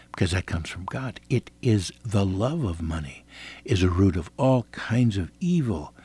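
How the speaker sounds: ordinary delivery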